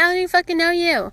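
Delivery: high, fast exasperated retort; mock-outraged, rapid comeback; indignant protest. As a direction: throw it back at a pushy stranger, quick and high. Acted protest to the stranger.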